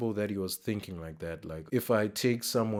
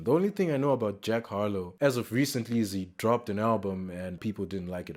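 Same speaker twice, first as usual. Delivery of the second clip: normal talking voice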